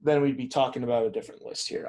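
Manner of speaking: ordinary talk, nothing marked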